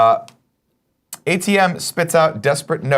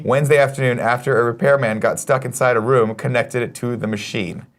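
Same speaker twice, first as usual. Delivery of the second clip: ordinary talk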